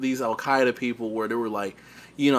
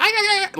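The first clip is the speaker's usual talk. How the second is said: high mock frantic yells; crazed yelling. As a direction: squeal like a crazed gunman. Mimicking crazed terrorists.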